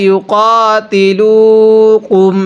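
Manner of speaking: slow, drawn-out Quranic chant; measured tajweed recitation. Shown as a demonstration. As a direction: recite each word slowly and melodically.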